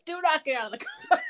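mock the husband's command, laughing; laughing cop order. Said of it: "So he's like".

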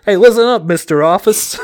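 loud, high New York tough-guy bark; shouted New York accent mock promo; Taz impression. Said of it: "Taz called Cody Rhodes Mister Office".

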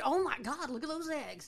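high, loud amazed exclaim; amazed gasp. Retold or imitated. Grocery clerk marveling at the eggs, high and loud.

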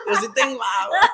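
high, loud laughing boast; giggly mock brag about having water. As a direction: squeal the boast through laughter.

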